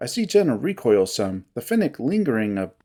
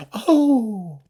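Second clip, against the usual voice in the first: bubbly feline; 8 semitones higher than usual. Acted.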